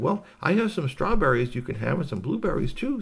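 a hint of a helpful hostess; kindly hostess. Storyteller voices the hostess.

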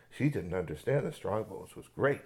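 plain reading voice

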